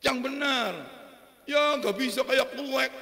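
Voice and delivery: loud, high exasperated teacher. Acted. Ustaz scolding a student who says kof wrong, loud. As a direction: raise the voice at the student's slip. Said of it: "scolding student's wrong sound".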